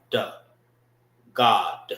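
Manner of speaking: "God" ending on a punched "Duh" sound; stressed final D. Shown as a demonstration. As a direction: hit the final D of "God" hard. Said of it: "end it with the D sound".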